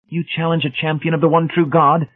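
high, disbelieving demand; pitched right up, affronted disbelief; incredulous. A narrator's reading. Brady challenged by an outsider, incredulous.

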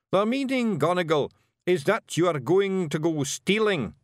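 a wee blue man laying out a grim plan; Scottish Feegle chief. A narrator's reading. Rob declares the meaning.

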